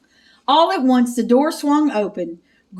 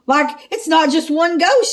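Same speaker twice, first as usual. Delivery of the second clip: higher, louder scared whine; frightened moan; 6 semitones higher, 4 dB louder than usual. Acted. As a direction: whimper like a cornered coward. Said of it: "Shaggy moaned".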